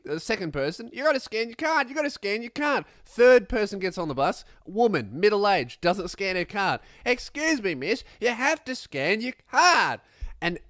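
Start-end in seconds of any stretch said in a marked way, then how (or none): 0.9-2.8 exasperated bus driver. Retold or imitated. Bus driver scolding another passenger, high exasperated order. 7.0-10.0 exasperated bus driver. Retold or imitated. Bus driver scolding another passenger, high exasperated order.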